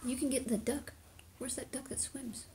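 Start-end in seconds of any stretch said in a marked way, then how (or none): 1.4-2.5 soft playful whisper. Real, not acted. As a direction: whisper it like a secret.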